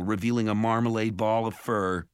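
ordinary delivery

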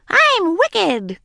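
high, loud girl playing a witch; wicked witch cry. A narrator's reading. Daisy playing witch to Ramona, loud excited cry. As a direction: cry it out with gleeful menace. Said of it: "cried Daisy".

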